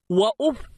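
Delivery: وأف said "wa-uf", no vowel after f; silent-ended fa. Shown as a demonstration. Teacher showing students وأف as "wa-uf", fa vowelless. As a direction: stop وأف on a bare f. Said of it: "بضم الألف وتسكين الفاء".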